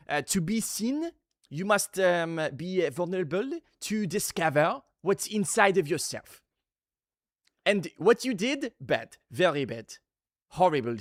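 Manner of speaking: put-on clown teacher with a French accent; halting, French-accented stern instructor. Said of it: "Great French accent".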